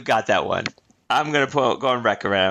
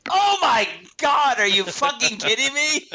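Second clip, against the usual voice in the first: high-pitched, amazed outburst; real astonishment; 11 semitones higher than usual. Real, not acted. Quiz host amazed at a correct guess, astonished. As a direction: react in stunned, high-pitched disbelief.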